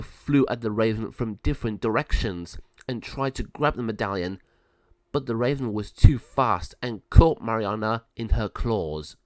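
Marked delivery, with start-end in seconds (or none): none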